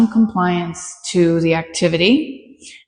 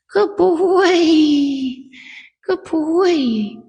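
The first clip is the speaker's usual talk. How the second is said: higher, gentle dog praise; 7 semitones higher, much slower than usual. Real, not acted. Trainer praises the dog after pressure, high praise. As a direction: praise him in a lifted voice.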